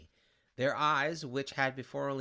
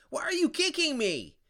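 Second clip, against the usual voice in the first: high, loud wailing cry; kicked sinner wailing at the one who kicked him; weeping scream; 11 semitones higher, 7 dB louder than usual. A narrator's reading. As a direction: scream it through tears. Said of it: "Weeping, he screamed".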